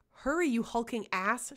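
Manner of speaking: loud, sharp bark at him; barked insult. Acted. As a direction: snap it out loudly and harshly. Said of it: "she barked".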